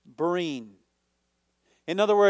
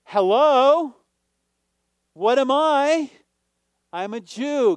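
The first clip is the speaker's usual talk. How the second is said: indignant Paul; 6 semitones higher, 6 dB louder than usual. Acted. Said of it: "He's saying".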